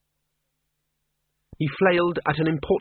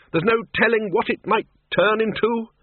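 high, raised voice over a din; shouted warning; 9 semitones higher than usual. Acted. Ridcully warns the Dean over the noise, shouting. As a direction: call out a warning urgently.

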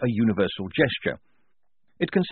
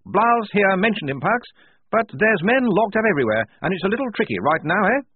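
quick, loud, higher officer brushing it off; brisk major; 11 semitones higher, 5 dB louder than usual. Acted. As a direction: rattle it off briskly. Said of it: "said the major briskly".

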